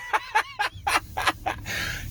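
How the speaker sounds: high, airy laugh with little voice; wheezing laughter; real laugh. Real, not acted. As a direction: laugh till the sound runs out. Cracking up telling story.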